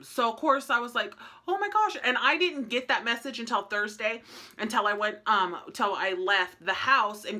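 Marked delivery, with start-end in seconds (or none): 1.5-2.0 alarmed gasp. Retold or imitated. Alarm at doctor calling.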